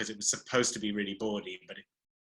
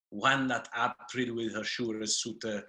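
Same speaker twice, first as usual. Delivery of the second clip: Middle English pronunciation. Shown as a demonstration. Showing how Chaucer was spoken, Middle English accent. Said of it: "speak it a little bit".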